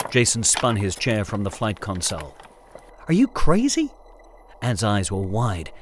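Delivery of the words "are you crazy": incredulous. Acted. Anne startled by plan.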